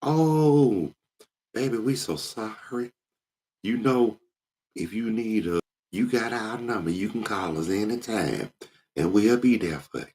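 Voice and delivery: high sugary fake sympathy; consoling church ladies. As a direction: coo it like you don't mean a word. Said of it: "you hear the other ladies".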